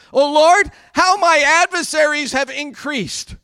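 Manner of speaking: loud, high declaimed lament; impassioned cry. Acted. Preacher replaying David's opening to God, loud impassioned cry. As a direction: call out to God, leaning hard on the first words.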